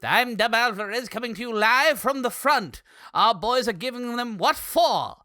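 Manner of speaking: 1940s announcer impression; old-time radio reporter live from the front; Dub Alvarez. Co-host mocking his partner's impression, 1940s announcer voice. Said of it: "sound like a nineteen forties announcer".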